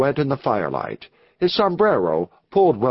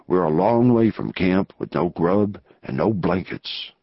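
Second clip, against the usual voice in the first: deep, low-pitched outlaw boss; disgruntled gang leader; 7 semitones lower than usual. Acted. Gang leader complains about being kept waiting, deep low voice.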